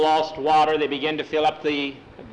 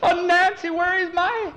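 high frantic voice, much higher and louder; panicked screaming; 4 dB louder than usual. A narrator's reading. Frightened mother crying out about her lost daughter. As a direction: gasp out the words in terror. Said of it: "Missus Miller was screaming".

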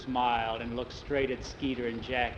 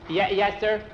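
startled reply; 6 semitones higher, 4 dB louder than usual. Acted. Startled boy answers stern teacher.

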